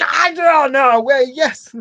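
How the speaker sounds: high, loud gleeful squeal; excited realization. Retold or imitated. Host recalls leaping from her seat, high gleeful cry. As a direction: jump up cheering as it sinks in. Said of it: "I was like".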